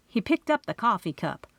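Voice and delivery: ordinary delivery, nothing marked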